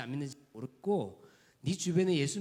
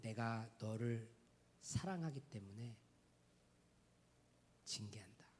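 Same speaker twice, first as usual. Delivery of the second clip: tender rebuke; 6 semitones lower, 20 dB quieter than usual. Retold or imitated. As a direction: speak it like a gentle father. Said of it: "뭐라고 하시냐면".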